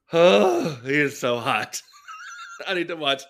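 loud, swooning oh over a hot man; crushing on an actor, loud excited swoon; excited gush. Real, not acted.

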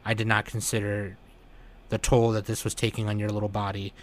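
father soothing his sick child, a hint of softness; gentle apology. Golem apologises to the sick girl.

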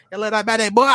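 high-pitched mock Jamaican squawk; Jamaican accent. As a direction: squawk it in exaggerated patois. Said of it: "talking that Jamaican accent".

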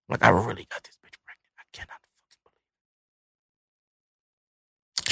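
hushed, barely voiced disbelief; whispering in shock that he got her pregnant; stunned whisper. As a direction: drop almost to a whisper, can't believe it.

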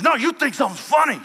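high, loud threatening yell; angry shout. Retold or imitated. Big guy at the party yelling at J P, angry shout. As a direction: bark it like a bully ready to swing.

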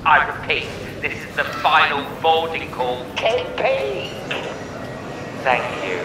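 echoey airport tannoy voice; flat echoey pa page. Pretend. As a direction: read it like a final boarding call over speakers. Airport boarding call announcement.

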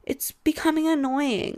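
mock-annoyed put-on voice; mocking dismissal. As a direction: mimic someone brushing off panic. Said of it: "this stupid person on your side being like".

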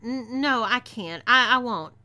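loud, stammered protest; demanding refusal. A narrator's reading. Captive refuses to become saloon girl, loud stammering protest. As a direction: refuse forcefully, stumbling on the words. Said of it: "I demanded".

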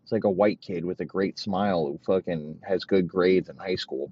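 ordinary talk

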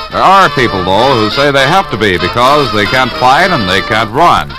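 normal talking voice

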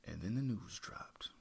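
hushed, for effect; dramatic whisper. Real, not acted. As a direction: drop to a whisper for the reveal. Dramatic reveal of news.

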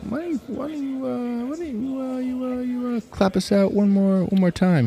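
high, thin put-on squeak; high squeaky voice. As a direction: pipe it up high and small. Odd high voice asking clap.

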